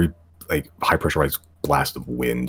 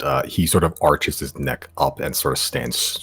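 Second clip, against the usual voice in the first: ordinary talk, nothing marked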